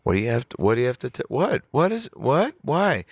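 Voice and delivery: rising, stammering disbelief; acted flustered confusion; mock panicked questioning. Retold panic at her confession.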